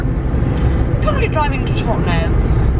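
slow drawn-out announcing; announcer voice. Pretend. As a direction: announce it grandly and slowly.

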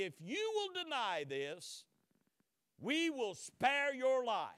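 slow, loud, high ultimatum; stern offer. Retold or imitated. Old friends warn Luther, slow and loud ultimatum. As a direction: lay down the deal slowly and firmly.

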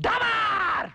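high shouted boom; explosive shout. Acted. Caller threatening a swindler with a bomb, shouting explosively. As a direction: burst it out like a blast.

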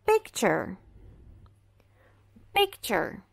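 picture with the t said as ch; pikt-cher, the t sounding ch; picture, careful English ch sound; English model word. Shown as a demonstration. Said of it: "Aqui nós temos".

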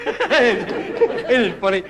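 chuckling through the words; laughing. Real, not acted. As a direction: laugh while asking. Laughing during stand-up.

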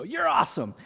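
high, bright mock enthusiasm; imitating Kenny's cheer. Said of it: "I did what my friend Kenny Maurer does".

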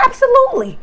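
high, bright-eyed "Absolutely"; eager host welcome. Pretend. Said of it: "They're like".

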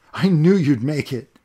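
loud, high joyful relief; excited. Acted. Boy greeting the man he thinks is his friend, loud and excited. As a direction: burst out with happy relief. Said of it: "he said excitedly".

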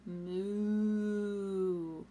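slow, long drawn-out moo; cow moo. Acted. Puppy George tries to bark but moos slowly. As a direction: stretch it into a lowing moo. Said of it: "George went".

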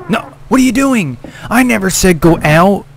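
high furious shout; angry yell. Real, not acted. Player screaming at his character for going out, angry yell.

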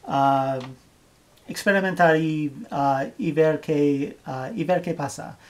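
ordinary talk, nothing marked

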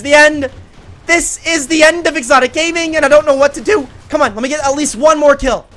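high, loud doom-crying; frantic despair. Real, not acted. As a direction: wail that all is lost, then plead. Despairs mid-fight.

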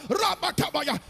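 high, forceful chanted burst. Retold or imitated. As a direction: push the tongues up toward a shout. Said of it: "Then it became louder".

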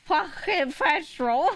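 high, drawn-out mimicked accent; imitating Apple's pronunciation. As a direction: drag out "Fucking petrol" in an exaggerated accent. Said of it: "what was Apple saying?".

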